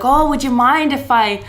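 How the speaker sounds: imitating a drunk Brit; high, loud British-accented plea; mock British party guest. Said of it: "this British accent".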